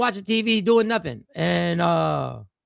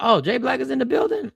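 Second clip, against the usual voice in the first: high, excited fan gushing over a celebrity; starstruck doctor; 9 semitones higher, 4 dB louder than usual. Retold or imitated. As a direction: act like the doctor just spotted a star, voice jumping up.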